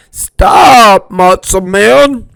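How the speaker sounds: high, loud cry of stop; cried out. Acted. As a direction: call after him, desperate. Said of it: "cried Grandpapa Soli".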